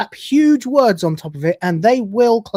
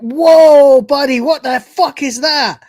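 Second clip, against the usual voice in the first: loud, high shocked outburst; shocked whoa; 7 semitones higher, 8 dB louder, slower than usual. Real, not acted. Streamer reacting to an alien on screen, shocked outburst. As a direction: blurt it out, startled and loud.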